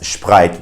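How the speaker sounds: SPRITE as "shprite"; older-generation German pronunciation. Retold or imitated. Teacher imitates older Germans, shp in SPRITE. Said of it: "sounds super funny and definitely incorrect".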